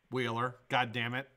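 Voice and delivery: ordinary delivery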